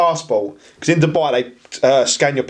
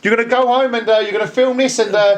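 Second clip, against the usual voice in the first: mock angry accusation; 7 semitones higher than usual. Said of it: "he goes".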